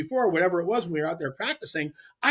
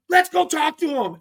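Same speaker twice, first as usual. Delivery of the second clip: eager retelling; 10 semitones higher, 6 dB louder than usual. Retold or imitated. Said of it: "And I'm like".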